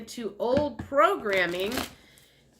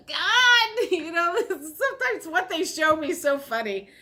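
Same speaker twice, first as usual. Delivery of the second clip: laughing; 8 semitones higher than usual. Real, not acted. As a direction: crack up at the funny image. Laughing at the vision.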